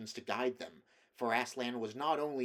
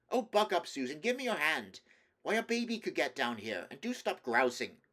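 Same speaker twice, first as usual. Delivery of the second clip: raised, exasperated urging, starting high; impatient chivvying; 5 semitones higher, 4 dB louder than usual. A narrator's reading. Peter helps Susan down the gorge, impatiently chivvying her. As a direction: chivvy a grumbling straggler along.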